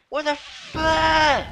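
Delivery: high, drawn-out cry of disbelief; slow, high-pitched exasperation; outraged squeal. Real, not acted. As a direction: stretch the last word into a long high wail. Rage at the game.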